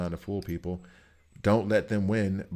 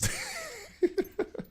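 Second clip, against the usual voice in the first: sudden high burst of laughter; 5 dB quieter than usual. Real, not acted. Laughing at his own cursing story, high burst of laughter. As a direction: break into a squeaky laugh.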